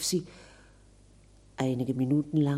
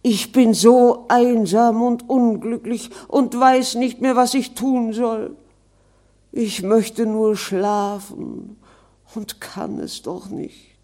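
higher, strange ghost voice, slowing and fading at the end; a weary spirit pleading; lonely ghost; 7 semitones higher, 9 dB louder than usual. Acted. Ghost laments his loneliness. (einsames, klagendes Gespenst)

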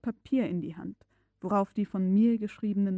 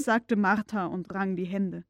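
ordinary delivery

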